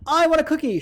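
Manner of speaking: Mister Haynes shouting for a cookie; shouted. Shown as a demonstration. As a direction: yell it out for the cookie. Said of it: "shouted Mister Haynes".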